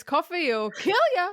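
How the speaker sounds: imitating Edward's line. Host recalling Edward's line after the teapot, playful imitation. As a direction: quote the villain with relish. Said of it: "What does he say?".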